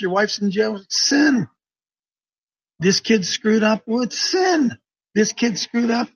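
preaching. Retold or imitated. Pastor retelling his words to the man in the wheelchair, mild preacher fervour. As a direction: urge him on like a fired-up preacher, only lightly acted. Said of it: "I said".

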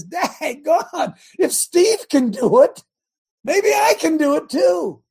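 high, loud, slow wonder; inspired churchgoer. Retold or imitated. Churchgoer inspired by Steve, high and loud wondering. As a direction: let the guy's hope swell as he realizes. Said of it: "some other guy sitting in there says".

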